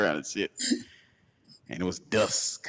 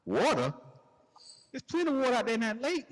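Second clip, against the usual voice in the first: spiteful retort; faster than usual. Retold or imitated. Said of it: "And I'm like".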